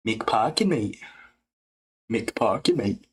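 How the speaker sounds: put-on British fighter voice; name-drop in a mock British accent; lightly done British impression; Tom Aspinall impression. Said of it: "like, the Aspinall voice".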